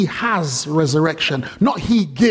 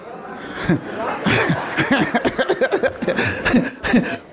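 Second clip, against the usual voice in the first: long breathy laughing fit; 5 semitones higher than usual. Real, not acted. Preacher picturing the crowd's reaction, long helpless laugh. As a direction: laugh at length at your own joke. Laughs at his own imagined scene.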